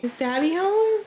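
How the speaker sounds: high, bright greeting to dad; excited high call. Real, not acted. Greeting husband arriving home.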